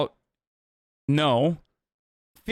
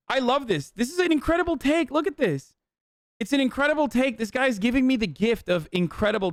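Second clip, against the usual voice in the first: delighted; 7 semitones higher than usual. Real, not acted. Gleeful at a troll's take.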